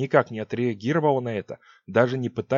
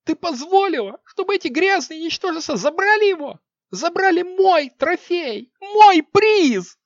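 furious shriek; 7 dB louder, slower than usual. Acted. Queen screaming at witch.

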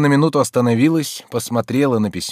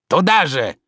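raised, indignant cry; thundering scorn; 4 semitones higher than usual. A narrator's reading. Servant drives off the nosy maid, indignant. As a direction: snap it scornfully, pitched up. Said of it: "загремел он".

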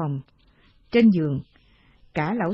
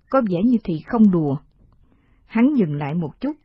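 ordinary delivery, nothing marked

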